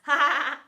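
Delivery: put-on loud burst of kids' laughter; giggling laughter. A narrator's reading. Kids laughing at their teacher, giggling laughter. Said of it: "This caused fits of giggles from the kids".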